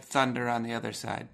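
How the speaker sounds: ordinary talk, nothing marked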